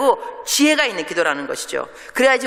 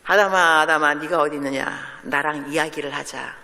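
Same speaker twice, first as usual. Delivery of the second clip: gentle divine call; 5 semitones lower than usual. Acted. God calls hiding Adam to talk, tender and patient. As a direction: slightly lower voice, softening toward the end.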